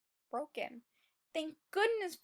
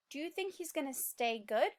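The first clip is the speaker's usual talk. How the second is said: ordinary delivery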